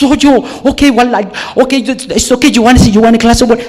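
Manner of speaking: high, loud, tumbling offers; mock frantic receptionist. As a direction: imitate someone flustered, rushing to help.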